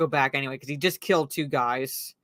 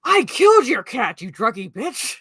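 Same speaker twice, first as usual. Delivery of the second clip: harsh retort; 7 semitones higher, 5 dB louder than usual. Retold or imitated. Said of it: "He's like".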